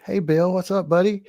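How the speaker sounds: high, eager brown-noser voice; butter up the guy in charge; sucking up. Pretend. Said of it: "I'm gonna be like".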